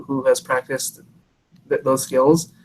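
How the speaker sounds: ordinary delivery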